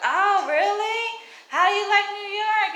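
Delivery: high mock sweet lady voice; mimicking the lady. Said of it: "the lady was like".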